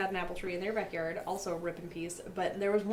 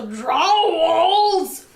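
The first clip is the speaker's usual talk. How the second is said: loud, heavily drawn-out drawl; Wilford Brimley; 7 semitones higher, 12 dB louder than usual. Retold or imitated. Describing grandpa's yelling voice, loud exaggerated drawl. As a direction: stretch it into a big slow old-man drawl.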